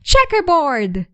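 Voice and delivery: high, loud playground shout. A narrator's reading. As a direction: call it out to tease. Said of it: "Someone else shouted".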